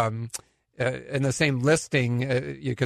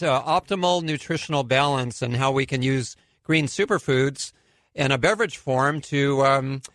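normal talking voice

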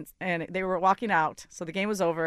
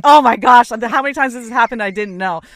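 louder, higher embarrassed gasp; flustered and mortified, louder; mortified exclamation; 4 semitones higher, 14 dB louder than usual. Retold or imitated. To the fan, a louder mortified apology. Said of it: "I'm like".

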